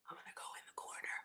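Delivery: hushed whisper, sneaking off. Real, not acted. Whispering to viewers while sneaking a bite. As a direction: whisper it like a secret aside. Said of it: "Why am I whispering?".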